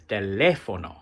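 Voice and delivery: "teléfono" with the accented é stressed; extra stress. Shown as a demonstration. Teacher models an accent mark example, extra stress on the "lé" of "teléfono". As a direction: lean hard on the second syllable of "teléfono". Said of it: "just put extra stress".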